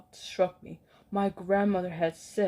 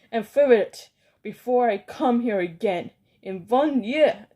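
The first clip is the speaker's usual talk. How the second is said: commanding shriek; 5 semitones higher, 8 dB louder than usual. Acted. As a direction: bark out the decree to terrify them. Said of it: "shrieked the Grand High Witch".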